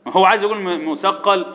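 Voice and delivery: usual speaking voice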